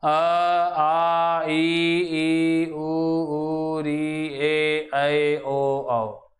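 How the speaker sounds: अ, आ, ई held long on a level tone; sustained, evenly intoned अ through औ; vowel pronunciation demo. Shown as a demonstration. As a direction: chant each vowel from अ to औ, very slowly. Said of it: "अब स्वर का उच्चारण करेंगे".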